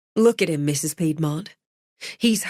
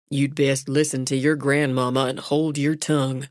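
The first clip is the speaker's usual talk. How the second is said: ordinary delivery, nothing marked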